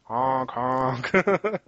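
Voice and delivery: mock truck horn honks; honking horn. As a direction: honk like a big truck horn. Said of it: "they blasted those horns".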